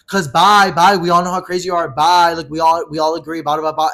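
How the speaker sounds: high, loud jeering goodbyes; imitated scornful dismissal. Friend claiming everyone agrees he's crazy, scornful. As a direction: imitate a friend waving someone off loudly.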